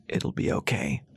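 low whispered comfort; a whispered, uncertain promise; hushed reassurance. A narrator's reading. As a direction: soothe her under your breath. Ras comforting Callie.

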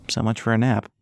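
ordinary delivery, nothing marked

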